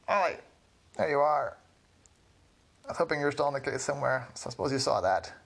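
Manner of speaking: high, lightly voiced old witch. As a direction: greet him like a friendly witch. Witch greets Ollie.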